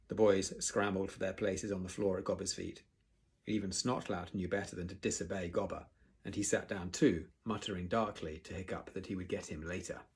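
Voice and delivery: ordinary delivery, nothing marked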